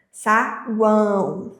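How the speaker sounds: "Saguão" drawn out slowly, nasal ão ringing through the nose; slow sounded-out word. Shown as a demonstration. Teacher to students, showing the nasal sound, slowly. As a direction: stretch "Saguão" so the nasal ão resonates. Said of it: "esse som ressoando pelo nariz".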